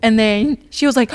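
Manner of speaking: ordinary talk, nothing marked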